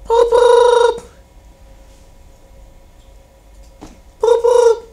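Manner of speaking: high squeaky boop boop; high-pitched ringtone noise; mock phone ring. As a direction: play the ringing phone.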